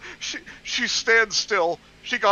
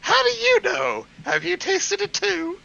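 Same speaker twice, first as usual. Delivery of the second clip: put-on shocked girl; 12 semitones higher than usual. Voiced girl's shocked retort.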